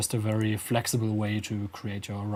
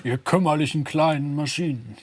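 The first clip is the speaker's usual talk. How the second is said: louder, higher mock monster line, unprocessed; 4 semitones higher, 5 dB louder than usual. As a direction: say it like a gloating monster, no effects. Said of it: "something like that".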